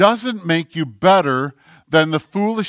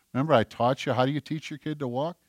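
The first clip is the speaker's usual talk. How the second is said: usual speaking voice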